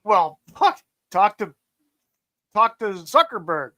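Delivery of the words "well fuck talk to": ordinary talk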